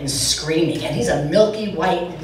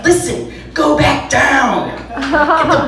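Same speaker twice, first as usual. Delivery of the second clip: higher, drawn-out firm command; mock ordering; 5 semitones higher, slower than usual. Family telling Aunt Judy to climb back down, mock ordering. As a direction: send someone away with comic insistence.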